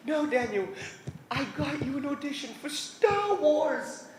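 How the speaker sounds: high, drawn-out squeal building on the big name; mock overwhelmed excitement. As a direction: announce huge news like a hysterical agent. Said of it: "She couldn't even speak".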